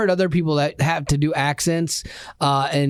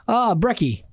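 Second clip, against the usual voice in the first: put-on Australian accent; 7 semitones higher than usual. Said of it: "Australians ... abbreviations".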